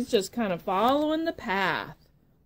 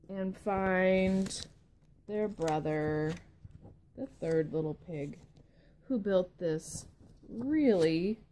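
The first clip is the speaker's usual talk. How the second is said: usual speaking voice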